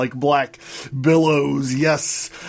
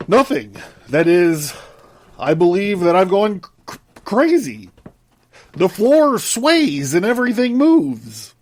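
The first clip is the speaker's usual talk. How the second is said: agitated panic; 5 semitones higher, 3 dB louder than usual. Acted. Jung panicking on the ship.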